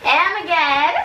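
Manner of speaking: high excited squeal with laugh; squealing excitedly. Real, not acted. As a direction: shriek with joy and giggle. Shoes arrive, squealing excitedly.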